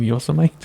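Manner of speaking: "Yosemite" as YO-suh-mite; mistaken old Yosemite. Retold or imitated. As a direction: rhyme the end of "Yosemite" with kite. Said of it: "I used to call it Yosemite".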